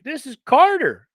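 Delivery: high, slow country twang; drawn-out Southern name; country accent. Retold or imitated. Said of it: "he said his country accent".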